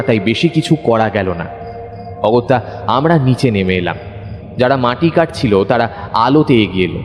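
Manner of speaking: normal talking voice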